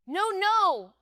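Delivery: high, loud cry of refusal; terrified protest; panicked scream. A narrator's reading. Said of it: "I screamed, panic surging through me".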